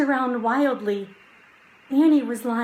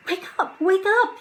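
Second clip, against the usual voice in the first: put-on young boy voice; 11 semitones higher, 4 dB louder, faster than usual. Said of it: "he said".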